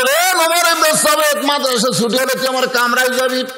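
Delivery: high-pitched angry summons; acted anger, high and ringing; angry teacher. A narrator's reading. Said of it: "রাগ করে বলে".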